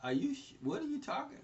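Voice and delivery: high, soft, stammering disbelief; stunned disbelief. Retold or imitated. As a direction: caught off guard, voice jumps up. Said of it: "I was like".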